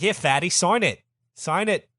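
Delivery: high, loud bullying Brit; British ambassador. Acted. Lamson demanding Farouk sign abdication, bullying British voice. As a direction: order him about in a British accent.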